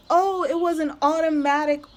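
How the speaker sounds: high, breezy dismissive voice; mock company rep. Imitating the company rep excusing the charge, high and breezy. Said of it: "They were like".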